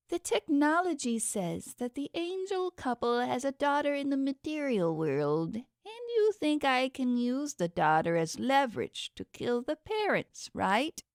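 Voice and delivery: a vain god sneering at the gadget; dragging out technology with sarcasm; scheming underworld god. A narrator's reading. God Zecheron voiced by narrator.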